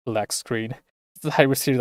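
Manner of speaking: usual speaking voice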